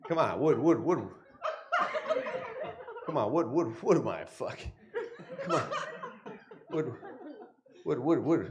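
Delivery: hushed, dazed mobster on mushrooms, soft and wondering; a mob boss whose mushrooms just kicked in, mumbling in awe; tripping mafia boss. Acted. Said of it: "my impression of the moment this mafia boss".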